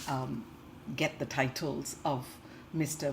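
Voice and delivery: ordinary talk, nothing marked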